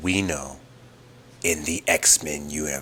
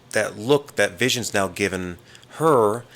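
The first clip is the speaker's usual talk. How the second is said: ordinary delivery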